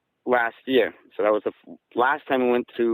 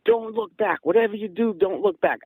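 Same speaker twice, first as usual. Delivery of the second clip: half-acted frantic warning, pitched up; retold panicked scream; 9 semitones higher than usual. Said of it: "he's screaming".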